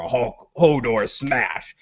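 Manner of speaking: slow, lightly voiced Hulk talk; Hulk-style brute. Host mimicking Hodor smashing skeletons, slow Hulk imitation. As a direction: draw it out like a mock Hulk.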